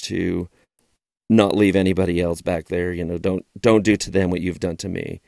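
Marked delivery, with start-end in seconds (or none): none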